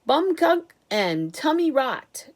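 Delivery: slow, loud scoffing outburst; exasperated cry. A narrator's reading. Mister Wonka waving off what-ifs with a loud cry. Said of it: "cried Mister Wonka".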